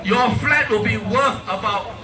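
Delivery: usual speaking voice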